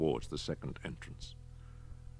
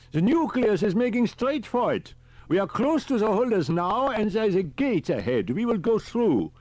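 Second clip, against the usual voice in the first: put-on high, fussy elderly professor; a high old professor calling on the radio; professor's voice; 8 dB louder than usual. A narrator's reading. Riker on the radio.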